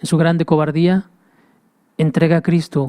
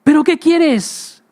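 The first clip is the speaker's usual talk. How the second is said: exasperated Pilate; 10 semitones higher, slower than usual. Acted. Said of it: "decía Pilato".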